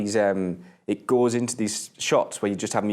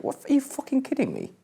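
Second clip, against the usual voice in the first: much higher, astonished squeal; genuinely floored by the news; shocked disbelief. Real, not acted. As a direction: voice shoots up in disbelief. Shocked by Arctic Monkeys news.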